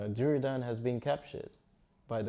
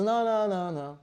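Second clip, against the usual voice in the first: high, louder string of noes; quick refusal; 9 semitones higher, 6 dB louder than usual. Acted. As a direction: wave the offer away in a raised pitch. Arjuna refuses the host's offer.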